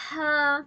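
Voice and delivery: breath on the palm for ہا; throat ha demo. Shown as a demonstration. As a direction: let the air rush out on ہا. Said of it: "جیسے".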